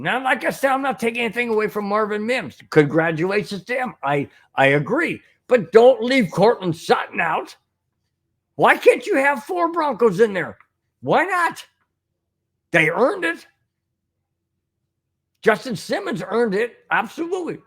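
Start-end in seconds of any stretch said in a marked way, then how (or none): 5.5-13.5 indignant rant. Real, not acted. Fan ranting about the snub.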